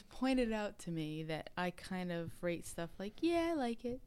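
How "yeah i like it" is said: put-on high-pitched, girly approval; mock girly rating. Host imitating how she rates gear, high girly approval. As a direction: imitate your own girly, noncommittal verdict. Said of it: "you do a girl rating".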